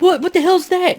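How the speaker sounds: very high, startled cry; spooked shriek. Retold or imitated. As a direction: shriek it like you just saw something. Spooked guy on radio.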